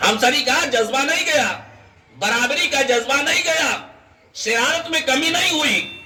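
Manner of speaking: high-pitched, ringing preacher's insistence; high, insistent preaching with falling endings; emphatic repetition. Real, not acted. Preacher repeats point with rising force.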